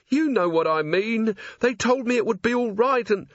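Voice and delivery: a frightened crook begging; cornered candle-maker pleading from a dark alley; whining Carey voice. Acted. Character voice for Carey.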